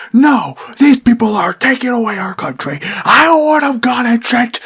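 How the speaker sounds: high, loud mock tirade; imitated yelling. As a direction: act out a stranger ranting at full tilt. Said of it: "he started yelling at my little sister".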